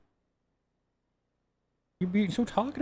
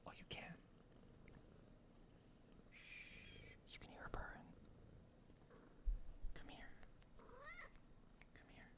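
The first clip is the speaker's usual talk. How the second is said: hushed whisper so the purr shows; soft whisper to the cat; 24 dB quieter than usual. Real, not acted. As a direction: whisper so the mic catches the purr. Whispering so purring is heard.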